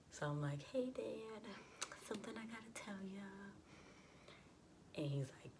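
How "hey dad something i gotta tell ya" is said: high, singsong lead-in; mock-sweet, tentative high pitch; acted sheepish approach. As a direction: ease into a confession in a small high voice. Acts nervously approaching her dad.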